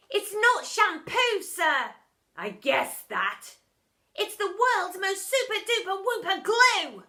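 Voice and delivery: high, loud, sly schoolboy; sly boy. A narrator's reading. Boy tricking the teacher, sly. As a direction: offer it with fake innocence. Bog's voice.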